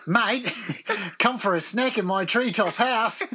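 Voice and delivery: high Australian twang; Australian accent. Shown as a demonstration. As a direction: invite like an Aussie mate. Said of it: "Do an Australian accent".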